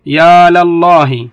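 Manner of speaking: يا لله said slow and loud, drawn out; slow demo example. Shown as a demonstration. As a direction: spell out "يا لله" slowly with the lam opened. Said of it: "أضرب مثال". (نطق "يا لله" ببطء وبصوت عالٍ مع فتح اللام)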